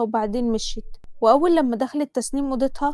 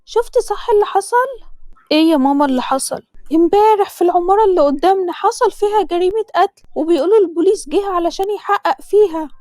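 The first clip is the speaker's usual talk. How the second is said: higher, louder fussing mother; mother's voice; 5 semitones higher, 3 dB louder than usual. Acted. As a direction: wake and fuss over the daughter.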